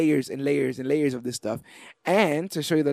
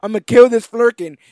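louder, higher hammed-up threat; mock threat; 6 semitones higher, 8 dB louder than usual. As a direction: play Rocket as an angry little gunslinger. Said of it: "And he's like".

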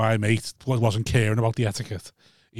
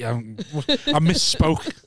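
ordinary talk

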